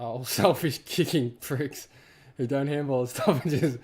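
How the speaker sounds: laughing through an annoyed rant. Real, not acted. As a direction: laugh while griping. Laughing rant about selfish players.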